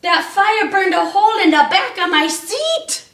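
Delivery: high, loud startled yell; storybook Santa yelping in pain. Acted. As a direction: yelp it out like he just got burned. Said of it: "he yelled out".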